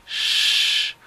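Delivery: "Sh" as pure air, no voice; unvoiced sound demo. Shown as a demonstration. Teacher shows the last sound of dish, "Sh" made only with air. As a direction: hold a long hushing "Sh". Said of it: "sino solamente aire".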